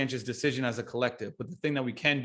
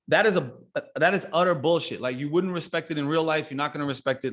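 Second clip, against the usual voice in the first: ordinary talk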